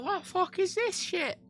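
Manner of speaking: high, slow, incredulous; baffled high exclamation. Retold or imitated. Seeing a yellow submarine in the street, slow baffled high outburst. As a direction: stretch it out in disbelief. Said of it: "And I'm like".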